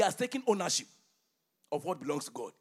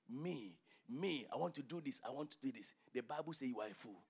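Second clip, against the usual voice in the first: ordinary delivery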